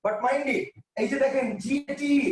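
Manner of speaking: ordinary talk, nothing marked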